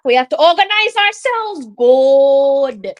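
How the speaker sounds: rally the team, then a loud drawn out good; loud fan urging; urgent urging. Real, not acted. Urging team during attack.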